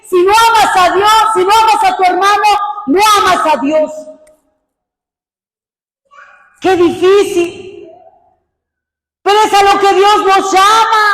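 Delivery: loud, high impassioned preaching; fervent preaching. Real, not acted. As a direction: preach it out with full force. Preaching at full force. (predicación apasionada y fuerte)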